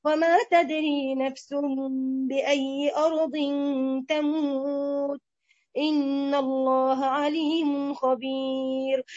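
slow, measured chant with drawn-out endings; Quran recitation. Acted. Teacher opens the lesson with the verse, slow Quran recitation. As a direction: recite it slowly, holding the long vowels.

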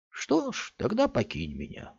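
bitter. A narrator's reading. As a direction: wave him off bitterly. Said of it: "с горечью в голосе".

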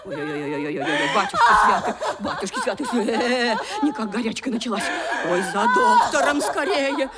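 hammed-up panicky fuss, half laughing; mock alarm. Stepmother fakes alarm at stepdaughter's fever, hammed-up. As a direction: fake a fluster over her illness.

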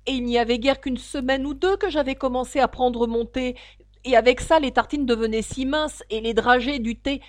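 trembling excuse to a monarch. A narrator's reading. The hatter explains his tea troubles to the king, trembling. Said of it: "d'une voix tremblante".

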